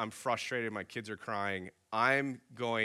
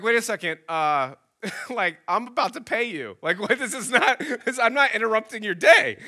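indignant, laughing; 8 semitones higher, 7 dB louder than usual. Retold or imitated. Indignant customer to rude server.

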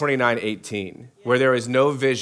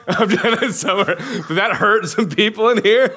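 laughing; 10 semitones higher, 3 dB louder than usual. Real, not acted. Laughing at own jab at cities.

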